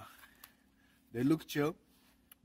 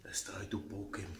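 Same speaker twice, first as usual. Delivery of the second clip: unvoiced sneaky whisper; 4 semitones lower, 13 dB quieter than usual. Reporter sneaking up on the animal, hushed whisper. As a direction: whisper so the animal won't hear. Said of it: "be quiet".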